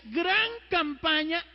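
loud, high, slow announcer read; mock poster announcement. Priest reading the protestant poster aloud, mock announcer voice. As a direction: proclaim it like a billboard. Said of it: "Decía".

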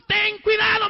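high, loud shout of caution; preacher's forceful admonition; retold stern warning. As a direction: cry the warning out.

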